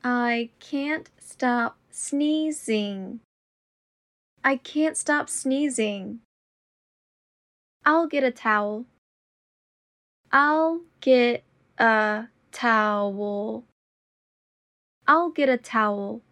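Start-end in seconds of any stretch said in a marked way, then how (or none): 0.0-3.2 slow and deliberate. Shown as a demonstration. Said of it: "repetition drill, slowed take". 10.3-13.6 very slow and deliberate. Shown as a demonstration. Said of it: "repetition drill, slowed take".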